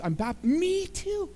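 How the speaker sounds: high and slow, savouring the match; thrilled me too. Acted. Man on the bridge thrilled to find a fellow Baptist, high and slow. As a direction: stretch it out with delight.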